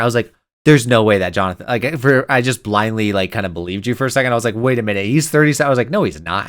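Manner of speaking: ordinary talk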